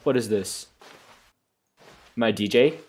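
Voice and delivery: ordinary talk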